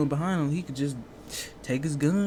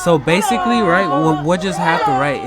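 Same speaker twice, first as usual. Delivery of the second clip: loud, emphatic explaining; 3 semitones higher, 10 dB louder than usual. Real, not acted. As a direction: push it out loud to the viewers. Explaining loudly to viewers.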